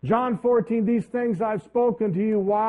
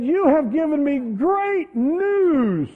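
much higher, louder, very slow and elated; overjoyed thanks; 9 semitones higher, 6 dB louder, much slower than usual. Retold or imitated. Pastor thanking the court clerk, slow and elated. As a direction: savour each word with delight. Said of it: "I said".

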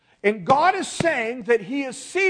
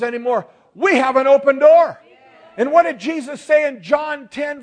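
raised, emphatic pulpit cadence; fervent preaching; 5 semitones higher, 8 dB louder than usual. Real, not acted. Preacher quotes Jesus as the door, fervent preaching. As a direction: proclaim it with rising conviction.

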